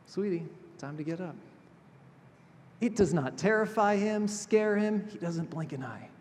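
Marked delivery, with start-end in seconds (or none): 0.1-1.3 much softer, tender wake-up call; gentle and calm. Retold or imitated. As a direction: ease her awake with a calm voice. Jesus calmly waking the girl.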